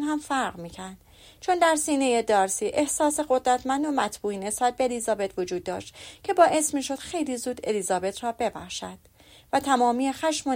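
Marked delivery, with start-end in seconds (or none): none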